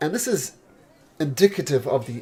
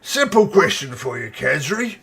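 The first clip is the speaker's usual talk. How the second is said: ogre voice; 6 dB louder than usual. Acted. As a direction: growl it out like a big ogre. Ozar keeps the ogre voice.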